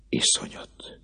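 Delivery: a breathless whispered shudder; hushed dread; horrified whisper. Acted. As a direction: barely breathe the horror. Priest recoils in horror.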